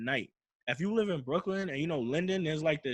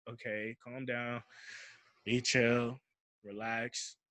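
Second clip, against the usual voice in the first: self-soothing; 2 semitones lower, 5 dB quieter, slower than usual. Retold or imitated. Retells talking himself down while scared.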